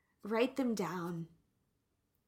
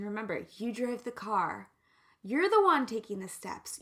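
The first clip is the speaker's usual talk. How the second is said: ordinary talk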